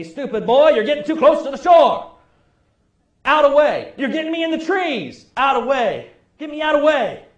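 put-on gruff old fisherman barking orders from the canoe; grandfather yelling. Old granddad yells at his grandson paddling the canoe, gruff and loud. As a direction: yell at the boy paddling. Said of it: "he would yell".

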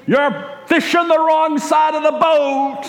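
high-pitched mock yell at the kid; dad yelling from the back of the boat; retold scream. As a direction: shout it across the canoe in mock alarm. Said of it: "And my dad screams".

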